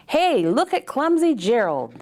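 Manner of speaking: high, loud jeering call; mock him like a schoolyard bully; sneering animals jeering at Gerald; sneering taunt. A narrator's reading. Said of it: "the animals all sneered".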